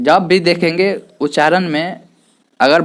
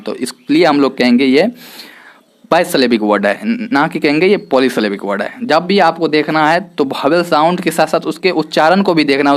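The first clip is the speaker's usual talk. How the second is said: ordinary talk, nothing marked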